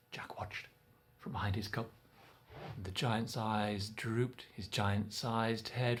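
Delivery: hushed, very soft telling; soft suspense whisper; hushed narration. A narrator's reading. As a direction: draw the listener in quietly. Tense sneaking scene.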